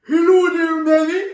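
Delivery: whingy dragon, high and very loud; loud, high whining hello; Gringer the Whinger. Acted. Dragon arriving at the kitchen table, greeting Mammy whingily. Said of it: "make Gringer the whingy dragon come and say".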